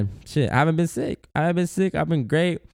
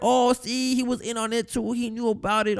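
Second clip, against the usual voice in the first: higher, gleeful I-told-you-so; gloating conspiracy theorist; 8 semitones higher, 4 dB quieter than usual. Retold or imitated. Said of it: "now that this was fired".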